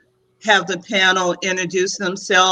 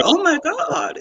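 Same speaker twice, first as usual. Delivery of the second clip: shocked oh my God; 9 semitones higher than usual. Retold or imitated. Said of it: "it's like she said".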